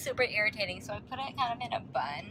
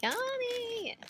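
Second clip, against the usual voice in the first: delighted squeal. Real, not acted. Delighted look at her food.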